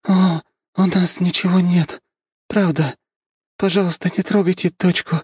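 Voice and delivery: weak hoarse pleading woman. Acted. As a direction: rasp out a frightened plea. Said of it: "сиплым голосом взмолилась она".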